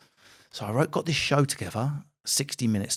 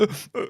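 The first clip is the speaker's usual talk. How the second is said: mock sobbing; 9 semitones higher than usual. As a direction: mimic the crowd's choked crying. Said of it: "They went from laughing to".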